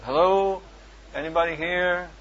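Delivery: loud call into an empty shop. Retold or imitated. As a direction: call out to find someone. Calling out in empty garage.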